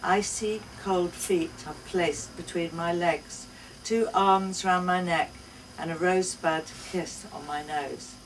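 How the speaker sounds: plain reading voice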